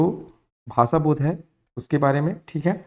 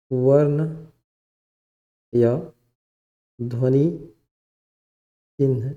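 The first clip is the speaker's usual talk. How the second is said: dictation; slower than usual. Real, not acted. Dictating heading for students to write.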